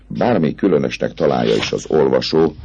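ordinary delivery

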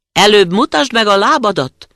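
high, loud little-goat voices; kids' wary demand. A narrator's reading. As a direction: call back high and firm, like wary kids. Cautious kids answer the wolf. (magas, hangos, óvatos gidahang)

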